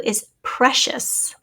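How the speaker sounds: is precious said very slowly, rising; precious drawn out for a spelling test; slow dictation. Shown as a demonstration. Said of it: "the word is number twenty-two".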